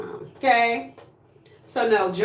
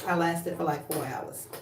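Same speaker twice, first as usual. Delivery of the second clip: usual speaking voice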